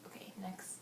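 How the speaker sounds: soft unvoiced whisper. Real, not acted. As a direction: whisper it as a quick aside. Aside while recording the video.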